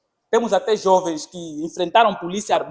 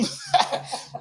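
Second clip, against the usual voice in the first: laugh; 6 dB quieter than usual. Real, not acted. Laughs at own point.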